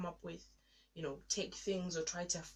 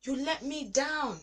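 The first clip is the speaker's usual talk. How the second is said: high, loud, pleading cry; anguished lament; 8 semitones higher, 7 dB louder than usual. Retold or imitated. Alone in the bathroom, crying out to God in anguish. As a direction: pour out hurt and blame at God. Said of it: "And I'm like".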